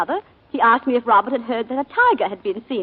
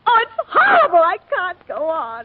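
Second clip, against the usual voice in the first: a high, loud sob that collapses into slow, broken words; overcome, sobbing then faltering slowly; breaking into tears; 4 semitones higher, 4 dB louder, slower than usual. Acted. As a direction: break down crying mid-confession, then barely get the words out. Breaking down recounting the killing.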